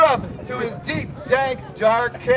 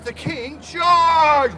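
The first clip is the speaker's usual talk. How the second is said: shout; 3 semitones higher, much slower than usual. Acted. Dramatic loud narration.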